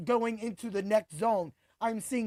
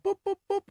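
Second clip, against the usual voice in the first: high little "boop" punch sounds; imitated punch noises; 10 semitones higher, faster than usual. Host mimicking Royval's punches, high booping noises. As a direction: pop out three high boops.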